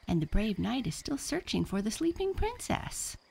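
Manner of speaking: high, eager protest; excited cry. A narrator's reading. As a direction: exclaim it with rising pitch. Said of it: "cried Max".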